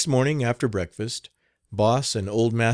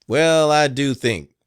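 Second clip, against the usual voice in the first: put-on slow, louder old man's praise; old man admiring; 5 semitones higher, 7 dB louder, slower than usual. A narrator's reading. Old master praises the boy's bell ringing, slow and pleased.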